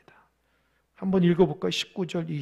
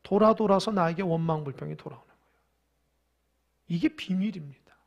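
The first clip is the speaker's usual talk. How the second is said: ordinary talk, nothing marked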